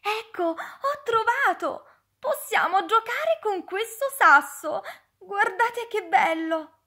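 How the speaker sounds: put-on excited child. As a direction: show off the treasure to friends. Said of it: "ed esclamò". (bambino entusiasta, voce più acuta)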